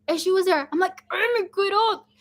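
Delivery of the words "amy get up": retold urging. Retold waking of sleeping sister.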